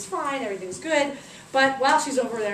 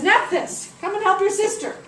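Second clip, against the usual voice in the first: calling out; 9 semitones higher, 7 dB louder than usual. Acted. Ra calls out to Nephthys.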